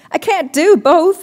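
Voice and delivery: high, slow, pleading; plead, overwhelmed; desperate. A narrator's reading. Maddie overwhelmed by binoculars and compass, desperate. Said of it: "I said desperately".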